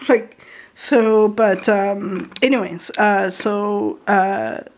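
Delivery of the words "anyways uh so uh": ordinary delivery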